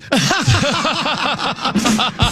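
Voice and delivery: high burst of laughter at his own pun; laughing at own joke. Real, not acted.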